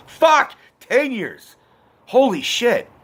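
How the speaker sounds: high, sharply falling cries of disbelief; dismayed outburst. Real, not acted. Speaker realizing it's been ten years, dismayed outburst. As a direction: exclaim in shock at your own age.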